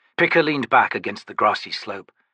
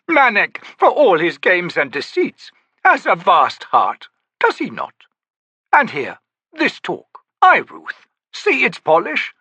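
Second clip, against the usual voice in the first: lofty, theatrical, higher; grand old Daru; 11 semitones higher, 5 dB louder than usual. Acted. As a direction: play the eccentric old trickster. Cropper continues.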